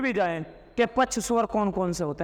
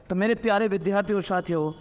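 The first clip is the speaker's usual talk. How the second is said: fast warm teacher address; rapid class address; faster than usual. Shown as a demonstration. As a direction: rattle off the greeting to students.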